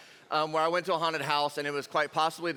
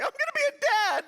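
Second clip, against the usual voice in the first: very high, louder squeal of delight; mock excitement; 4 dB louder than usual. Teen glimpsing own future, squealing with mock excitement. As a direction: squeal it in comic wonder.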